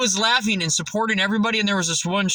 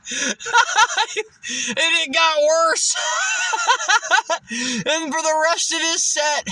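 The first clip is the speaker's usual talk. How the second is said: high, laughing through the words; gleeful laughing narration; laughing while telling; 10 semitones higher, slower than usual. Real, not acted. Telling how the bully bombed, laughing high. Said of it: "laughing through the story".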